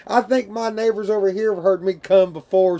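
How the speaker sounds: ordinary talk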